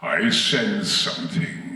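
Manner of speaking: slow, low, ominous villain; Darth Vader. Acted. Actor demonstrates Vader sensing an old enemy, slow low menace. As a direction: draw it out low, sensing a presence. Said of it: "his movement as Vader".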